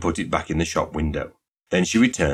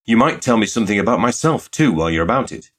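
usual speaking voice

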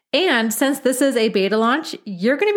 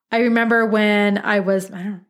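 normal talking voice

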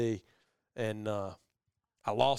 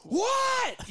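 high, loud shouted what; retold holler; 4 dB louder than usual. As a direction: yell it back across the woods. Brooks hollering back.

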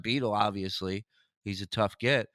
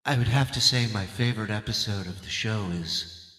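a lightly voiced Oprah, louder and grand; Oprah impression; 3 semitones lower, 5 dB louder than usual. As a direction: answer like a talk-show queen. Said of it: "I'm gonna answer it as Oprah".

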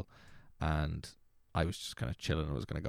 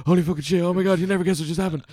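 much higher and much louder, fast and breathless; frantic excitement; 13 semitones higher, 12 dB louder, faster than usual. Retold or imitated. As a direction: blurt the news out in a frantic rush. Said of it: "me frantically being like".

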